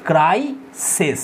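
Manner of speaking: ক্রাইসিস drawn out slowly in two parts, CRAI... SES; syllable-by-syllable demo. Shown as a demonstration. Teacher showing students the plural, ক্রাইসিস slowly broken up. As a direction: say ক্রাইসিস very slowly, split before the final SES. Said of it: "তাহলে হয়ে যাবে".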